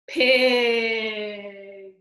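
loud, long-drawn answer; triumphant storytime reveal; excited reveal. Pretend. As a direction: announce it big, stretching the word.

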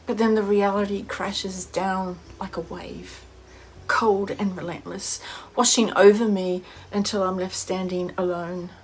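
reflective dramatic reading with long pauses; measured, pensive recital, fading at phrase ends; wistful monologue. Acted. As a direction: deliver a heartfelt soliloquy on loss, phrase by phrase. Performs a written monologue about loss.

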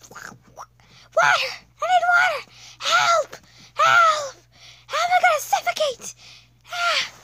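high, loud desperate cries for help; terrified. Acted. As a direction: shriek like you're suffocating. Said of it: "I cannot make a scared voice".

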